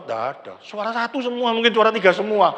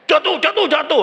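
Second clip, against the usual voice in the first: mock falling cry; 6 semitones higher, 6 dB louder than usual. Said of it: "Terus teriak".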